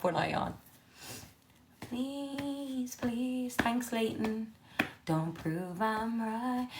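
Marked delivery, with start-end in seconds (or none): 1.8-6.7 high, soft begging; soft pleading. Real, not acted. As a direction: plead under your breath. Pleading at the screen.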